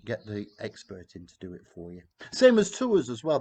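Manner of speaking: normal talking voice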